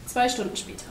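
ordinary delivery, nothing marked

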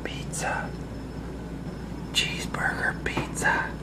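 soft hushed whisper. Acted. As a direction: whisper it like a secret. Whispered food announcement.

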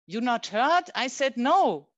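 loud, high angry no; angry refusal. Acted. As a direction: put him in his place. Refusing a man again.

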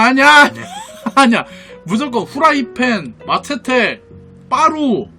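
normal talking voice

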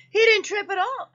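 high and loud with wonder; Blue Jay marvels aloud; excited Blue Jay. A narrator's reading. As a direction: exclaim it, amazed. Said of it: "added Blue Jay".

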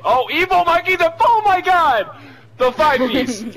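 high, loud squeal of delight; thrilled high yell; excited shriek. Real, not acted. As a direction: shriek with joy at the big kill. Thrilled at teammate's kill.

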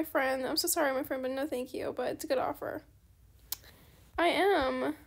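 usual speaking voice

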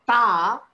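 "ta" with tongue touching the teeth; dental ta. Shown as a demonstration. Teacher contrasting danta with talu, dental ta. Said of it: "the tongue will touch the danta".

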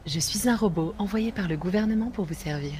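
lightly done robot voice. Pretend. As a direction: speak like a polite robot assistant. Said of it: "tu es vraiment un robot".

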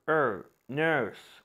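نيرس drawn out slowly on er; slow er sound. Shown as a demonstration. Teacher shows the er in nurse slowly. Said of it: "نيرس، لا".

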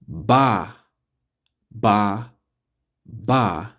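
ba said on its own, slightly punched; teacher drilling the syllable ba. Shown as a demonstration. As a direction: sound out ba, ba, ba. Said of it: "B A, that's Ba".

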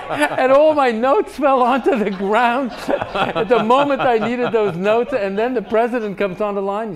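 words riding on a big laugh; laughing while talking. Real, not acted. Ambassador laughing as he recalls dropping his notes.

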